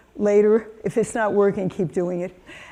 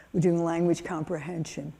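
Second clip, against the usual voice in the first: ordinary talk, nothing marked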